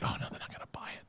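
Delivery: fast worried whisper aside. Retold or imitated. Dad fearing kids won't buy it, fast whisper. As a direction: whisper your doubt under your breath. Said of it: "And I'm like".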